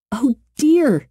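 put-on startled girl fretting; a child nervously apologizing to a shaking flower. A narrator's reading. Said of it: "she said nervously".

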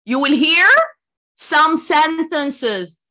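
some sentences drawn out very slowly again; slow emphatic repeat. Shown as a demonstration. Teacher repeating the instruction slowly and loudly. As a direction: stretch each word, some sentences. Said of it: "repeat instruction".

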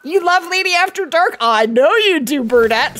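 loud, playful tease; playful teasing. Real, not acted. Teasing a viewer loudly.

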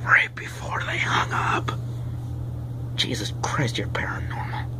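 hushed, voiceless whisper; whispered aside. Acted. Character whispers to self after friends' call, hushed aside. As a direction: whisper it under your breath.

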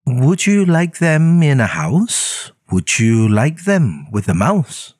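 Sam-I-Am voice. Acted. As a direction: pester him sweetly with the offer. Sam voice, coaxing questions.

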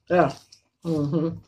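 muffled behind hand; talking while eating; mouth full, chewing. Real, not acted. Seen 1: Fingers at lips, cheeks working, eating during whole labelled stretch.

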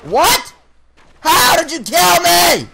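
high, outraged yell; angry shout. Real, not acted. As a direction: shout it in disbelief. Killed, yelling in frustration.

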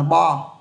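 "bo" with an open O vowel; corrected reading. Shown as a demonstration. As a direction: say bar as "bo", no ay sound. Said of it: "không phải nắm A mà nắm O". (đọc "bo" với âm o, không phải ây)

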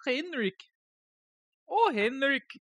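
mock Swedish accent. Streamer teasing viewer Henrik, mock Swedish accent. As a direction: have another go at sounding Swedish, high up. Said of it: "Is that what Swedish people sound like?".

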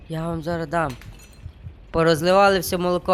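usual speaking voice

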